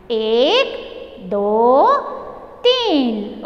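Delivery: slowly stretched, spaced-out numbers; teaching count. Shown as a demonstration. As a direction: count one by one for children to copy. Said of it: "तो तीन तक आपको बोलना सिखा रही हूँ". (हर गिनती धीरे, खींचकर बोलना)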